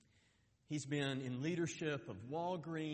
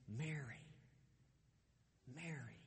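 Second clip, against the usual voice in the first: tender hushed call; 9 dB quieter than usual. Acted. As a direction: say her name like a secret. Jesus calls Mary by name, retold.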